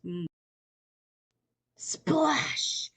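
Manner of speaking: ordinary delivery, nothing marked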